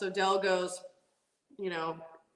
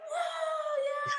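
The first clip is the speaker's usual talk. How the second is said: very high, drawn-out mock whoop; excited dad cheering. Dad on the starting line cheering his daughter's run, excited. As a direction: imitate an overjoyed cheer without full volume. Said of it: "so excited".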